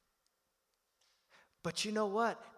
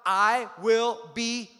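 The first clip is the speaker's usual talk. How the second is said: loud, very slow, word by word; leading the congregation; 4 semitones higher, 8 dB louder, much slower than usual. Shown as a demonstration. Preacher asking the congregation to help out, loud and slow. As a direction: draw it out so everyone can join. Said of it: "Help me out".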